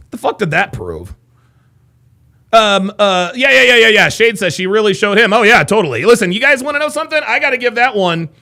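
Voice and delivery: ordinary talk, nothing marked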